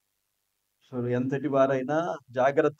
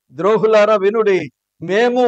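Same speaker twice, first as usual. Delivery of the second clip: much higher, louder angry cry; furious shout; 5 semitones higher, 9 dB louder than usual. Retold or imitated. Moses shouting in anger as the whole assembly listens. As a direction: roar it at the rebels. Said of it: "ఆ కోపములో ఎంత గట్టిగా మాట్లాడుండొచ్చు".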